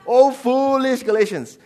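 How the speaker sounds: loud, high cry of reproach; exclaimed rebuke. Acted. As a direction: lean hard on 'foolish'. Acts Paul rebuking Galatians.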